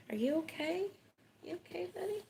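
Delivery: high-pitched pet talk, rising at the end; high, concerned coo to a buddy; checking on a pet. Real, not acted. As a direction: ask in a high little voice, as if to an animal. Checking on the cat.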